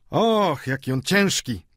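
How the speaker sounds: high drawn "och", then dropping; stękanie z wysiłku. A narrator's reading. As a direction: exclaim at the heavy load. Poiret dźwiga chorego. (wysokie „och” przy dźwiganiu)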